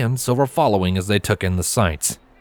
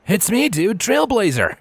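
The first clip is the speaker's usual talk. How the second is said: higher, cocky stallion voice; Trailblazer's voice; 11 semitones higher than usual. Acted. Trailblazer introducing himself, higher and cocky. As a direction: say it higher with a smirk.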